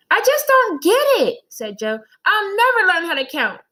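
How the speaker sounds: put-on high, loud exasperated kid; a confused boy throwing up his hands; frustrated child Joe. Boy complains to his teacher, high and exasperated.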